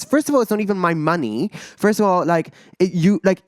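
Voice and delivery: exasperated, incredulous comeback; upset, heated retort. Retold or imitated. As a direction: re-enact a heated argument, pitch up throughout. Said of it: "I was getting so upset".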